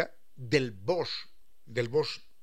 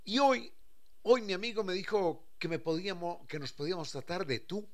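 excited friend; 5 semitones higher than usual. Retold or imitated.

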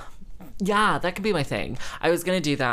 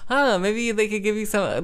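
mocking mother; 7 semitones higher than usual. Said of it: "my mother's like".

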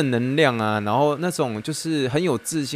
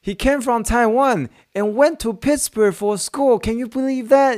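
high, slow, amazed calling voice; excited husband; 12 semitones higher, slower than usual. Retold or imitated. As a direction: call your wife over in disbelief. Husband calls out to his wife. (丈夫惊奇地大声叫太太)

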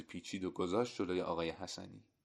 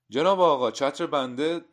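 high, much louder hail; urgent call; 7 semitones higher, 12 dB louder than usual. A narrator's reading. Man hailing the haberdasher about his umbrella, urgent. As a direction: call out pressingly for attention.

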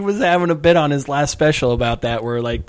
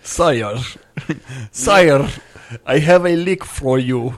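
mock Russian accent; slower than usual. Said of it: "He has to sound Russian".

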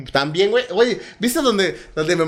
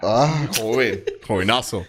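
lower, slow hammed-up greeting; deep slow mock voice; 10 semitones lower, slower than usual. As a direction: drag out the greeting in a low voice. Greets friend in put-on voice.

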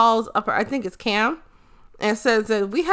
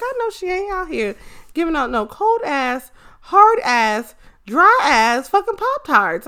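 sassy disbelief; 6 semitones higher than usual. Retold or imitated. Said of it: "I was like".

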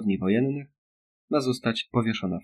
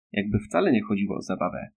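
ordinary delivery

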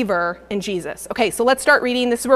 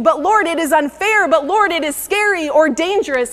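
higher, louder string of excuses; rising protests; 4 semitones higher, 3 dB louder than usual. Retold or imitated. Preacher voicing believers' excuses to God, raised and pleading. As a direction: pile up the objections with building force. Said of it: "you said".

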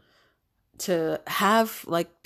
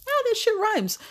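delighted surprise. Real, not acted. Delighted at own rhyme.

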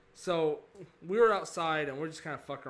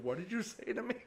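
shocked; 8 semitones higher than usual. Retold or imitated. Retells shocked reply to stranger.

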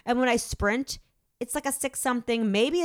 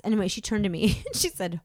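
normal talking voice

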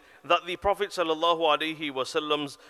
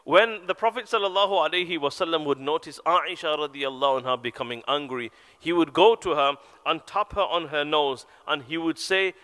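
normal talking voice

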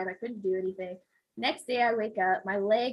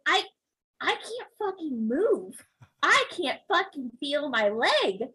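slow pained complaint; 7 semitones higher than usual. Retold or imitated. Panicked complaint to doctor.

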